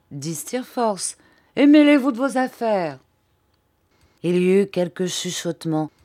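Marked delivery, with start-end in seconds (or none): 1.5-3.0 loud, high rebuke; defiant outburst. A narrator's reading. Pupil telling his teacher off, defiant outburst. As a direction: tell them off insolently, voice raised.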